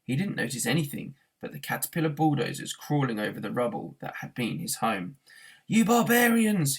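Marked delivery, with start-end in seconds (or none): 5.7-6.8 high, loud, slow furious yell; enraged yell at the demolition crew. A narrator's reading. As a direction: roar it at the bulldozers. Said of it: "he yelled".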